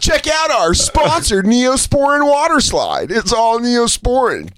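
high, slow hammed-up ad voice; mock announcer. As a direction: sell it like a cheesy ad.

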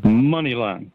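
normal talking voice

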